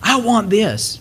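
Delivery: mild mock tantrum; mock child's anger. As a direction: imitate a kid angrily demanding. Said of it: "like, in anger".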